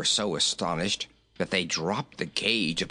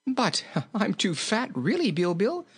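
high, amused remonstration; jolly king protesting; 10 semitones higher than usual. A narrator's reading. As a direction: protest through a little laugh.

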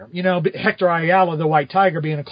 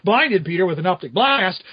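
normal talking voice

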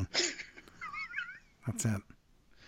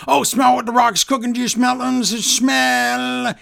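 JR voice, higher and louder, hammed up; mock JR; 9 semitones higher, 3 dB louder than usual. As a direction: ham up JR calling The Rock. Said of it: "I don't remember J R going".

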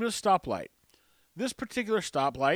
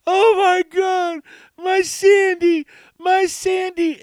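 very high, loud, slow wailing lament; mock wailing; 12 semitones higher, 12 dB louder, much slower than usual. Wheelchair lady wailing as her dog leaves, high slow mock cry. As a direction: wail over the lost dog, drawn out and high.

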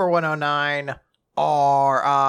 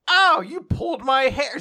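mock dub line; 11 semitones higher, 4 dB louder than usual. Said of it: "having a line like".

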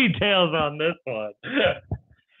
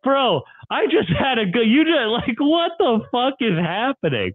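a raised, baffled what-is-going-on; stunned disbelief; 8 semitones higher than usual. Retold or imitated. Friend with a gun, retelling his baffled outburst, high and stunned. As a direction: pitch jumps high in shocked disbelief. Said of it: "And I'm like".

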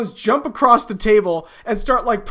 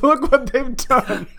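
goblin voice; 5 semitones higher, slower than usual. Acted. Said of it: "another voice says".

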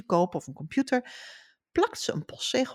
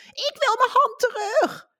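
put-on much higher, louder wailing child's voice; shrieking little girl; 5 dB louder than usual. A narrator's reading. Girl with her hand stuck in mailbox, shrieking. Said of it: "gilde Godelieve".

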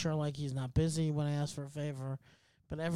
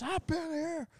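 very high, strained mock yell at normal volume; imitated yelling; 13 semitones higher, slower than usual. ER patient complaining about the wait, imitated high yell. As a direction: imitate a fed-up patient hollering down the corridor. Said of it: "he started yelling".

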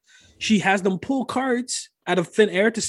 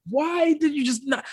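mock exasperated; 9 semitones higher than usual. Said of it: "I was like".

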